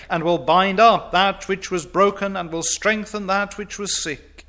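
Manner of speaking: fervent revival preaching; preaching at full force. Acted. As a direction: thunder the promise from the pulpit.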